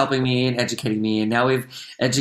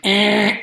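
loud, high "wrong answer" buzz; mock buzzer noise; 10 semitones higher, 6 dB louder than usual. Producer correcting an actor in rehearsal, mock buzzer. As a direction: buzz in to cut someone off.

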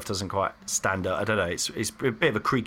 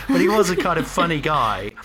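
ordinary delivery

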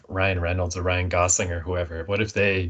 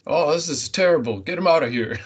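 imitating the detective's Canadian accent, higher and louder; mock Canadian detective, raised pitch and volume; 7 semitones higher, 4 dB louder than usual. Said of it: "I just couldn't not hear the oats".

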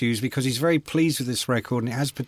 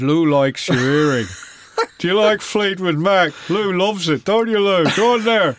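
[name] impression; 4 semitones higher, 4 dB louder than usual. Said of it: "[name] was there and he said".

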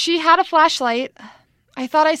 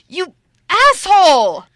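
loud, higher angry insult; angry yell; 6 semitones higher, 7 dB louder than usual. Acted. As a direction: snap it out furiously. Angry after being scared.

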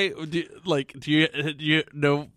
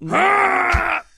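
mock scream; 14 semitones higher, 6 dB louder than usual. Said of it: "And Toby was like".

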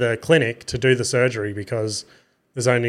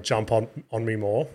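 ordinary talk, nothing marked

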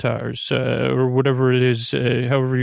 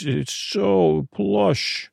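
dreamy sigh; 5 semitones higher, much slower than usual. Acted. Back rub bliss.

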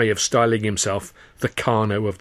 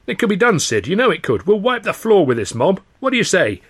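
rousing, higher-pitched pitch to the group; eager; 6 semitones higher than usual. A narrator's reading. Jimmy rallying the company and Sid, excited. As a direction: whip up the company's enthusiasm. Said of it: "he cried".

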